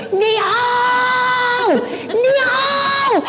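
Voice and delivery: very high, long-held yell of a name; re-enacted scream, stretched out; retold screaming. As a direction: call desperately across a noisy crowd. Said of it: "I'm screaming my head off".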